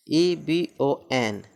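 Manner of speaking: letters ई बी ओ एन read slowly one by one; letter-by-letter spelling. Shown as a demonstration. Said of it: "ई शुरू में है ना".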